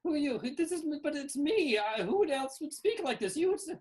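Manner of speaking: very high, loud, repetitive creature voice; frantic hatchling creatures. Acted. Newly hatched Winky Wallies question each other, high frantic chatter. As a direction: chatter insistently in a squeaky high voice.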